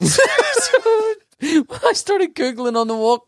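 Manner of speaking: high-pitched, talking through a laugh. Real, not acted. Comedian resumes his story after the co-host's joke, laughing. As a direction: squeeze the words out slowly while giggling.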